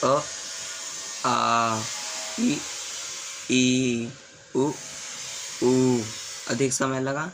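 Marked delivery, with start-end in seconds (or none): 0.1-6.0 short vowel clipped, long vowel drawn out; short and long vowels demo. Shown as a demonstration. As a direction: say each pair short then long. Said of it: "इनका उच्चारण कीजिए".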